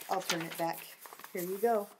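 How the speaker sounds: ordinary delivery, nothing marked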